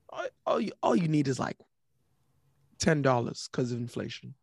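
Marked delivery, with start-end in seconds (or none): none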